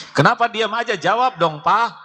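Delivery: wife nags her silent husband, high and sharp; nagging wife. Acted. Wife complaining to her husband, high-pitched scolding. Wife demands answer in skit.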